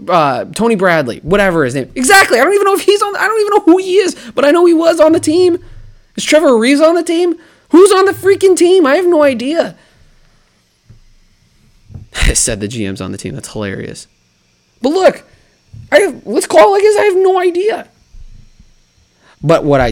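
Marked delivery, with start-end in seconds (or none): 2.0-9.8 exasperated rant. Real, not acted. Ranting, voice up high. 14.8-17.9 exasperated rant. Real, not acted. Rant continues, voice still high.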